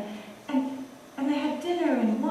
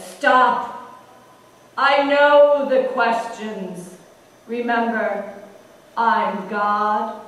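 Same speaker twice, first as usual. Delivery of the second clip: slow, firm lower-voiced God; stern God; 3 semitones lower, 7 dB louder, much slower than usual. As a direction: halt him with calm authority. God cuts him off.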